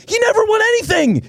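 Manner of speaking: high-pitched exasperated yell; exasperated shout. Real, not acted. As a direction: cry out in protest, voice jumping way up. Exasperated rebuttal of Stafford defenders.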